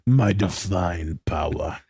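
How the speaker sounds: low, slow, godlike boom; a lightly voiced Xerxes; deep god-king voice.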